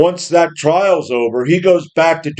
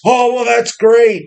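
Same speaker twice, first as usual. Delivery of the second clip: naive approval; 7 semitones higher than usual. Retold or imitated. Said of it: "people out there that will go".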